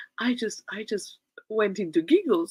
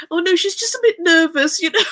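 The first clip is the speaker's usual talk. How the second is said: higher, louder, laughing through it; laughing defence; 11 semitones higher, 9 dB louder than usual. Retold or imitated. Friend excusing her giggles to neighbour, laughing loudly. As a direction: cover for her while laughing.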